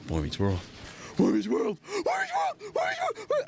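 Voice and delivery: imitating a fan losing it, climbing into a screech; starstruck outburst. Starstruck actor retold spotting Ryder.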